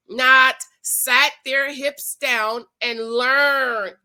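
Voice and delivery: "not" stressed, slow and loud; slow emphatic. Real, not acted. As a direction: hammer each word slowly. Emphatic preaching point.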